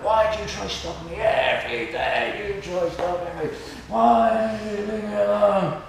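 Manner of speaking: hammed-up yelled protest, rising in pitch and volume; imitated screaming complaint. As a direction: wail it like an exasperated stranger. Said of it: "and they scream at you".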